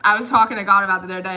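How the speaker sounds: usual speaking voice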